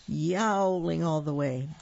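ordinary delivery, nothing marked

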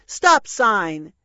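loud commanding "Stop sign!"; shout. A narrator's reading. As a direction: bring the pirates to a halt. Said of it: "and shouted".

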